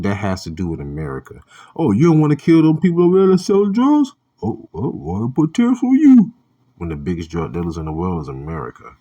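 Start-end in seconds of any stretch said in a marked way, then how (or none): 1.7-6.3 high, loud sarcastic mock voice; mock outrage, much higher and louder. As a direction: ham up a scornful caricature of the US. Mocking America's stance on China.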